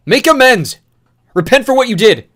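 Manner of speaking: very loud, high screaming; torn scream. Acted. Newt screaming at Thomas to repent, torn scream. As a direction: tear the words out of you. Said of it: "The words tore out of him".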